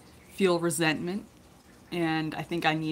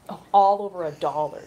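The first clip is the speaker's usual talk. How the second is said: dismissive scoff; 6 dB louder than usual. Retold or imitated. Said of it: "she said".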